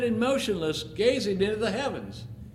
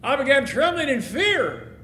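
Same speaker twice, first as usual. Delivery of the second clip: ordinary delivery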